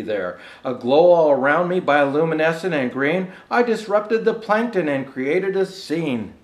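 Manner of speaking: measured, rhythmic storybook reading; lilting holiday verse reading; poem recitation. Acted. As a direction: read it like a bedtime Christmas rhyme. Rhymed Christmas poem read aloud.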